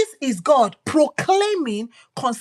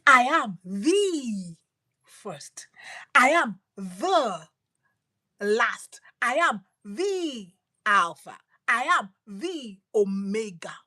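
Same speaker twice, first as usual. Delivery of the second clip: slow declaration; slower than usual. Acted. Jesus's words drawn out.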